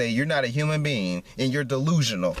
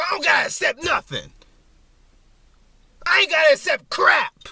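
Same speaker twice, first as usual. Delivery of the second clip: much higher and louder, furious defiant yell; angry shout; 13 semitones higher, 6 dB louder than usual. Real, not acted. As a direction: shout them down, real anger. Defiant outburst at critics.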